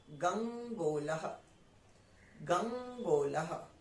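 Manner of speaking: गंगोलह drawn out slowly, half nasal "गंग" then "गोलह"; slow spelled-out reading. Shown as a demonstration. Teacher modelling गंगोलह slowly for students. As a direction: sound out गंगोलह syllable by syllable, slowly. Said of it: "तो हम क्या बोलेंगे".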